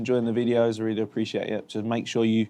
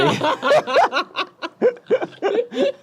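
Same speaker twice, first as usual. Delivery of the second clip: shrill burst of real laughter; high squealing laugh; 5 dB louder than usual. Real, not acted. Friend laughs at Sammy being snappy, squealing laugh.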